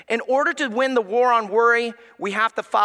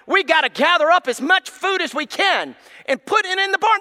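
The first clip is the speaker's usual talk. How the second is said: mock panicky birds; 4 semitones higher, 4 dB louder than usual. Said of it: "He said".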